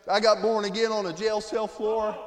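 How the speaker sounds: ordinary talk, nothing marked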